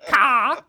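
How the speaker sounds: shrill cawing like a crow; loud raven caw. Shown as a demonstration. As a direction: squawk it like an angry raven. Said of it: "That's probably my best, uh, best impression".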